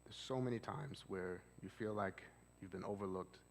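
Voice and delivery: soft, low, slow soothing counselor voice; a calm therapist easing a distressed client. Shown as a demonstration. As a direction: talk them down gently, slow and quiet. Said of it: "talking that low and talking that slow".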